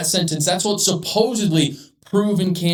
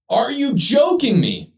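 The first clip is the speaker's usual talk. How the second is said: high, slow incredulous outrage; exasperated; 8 semitones higher, slower than usual. Real, not acted. Commentator asking the Daily Mail in high, slow disbelief. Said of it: "getting emotional or amped".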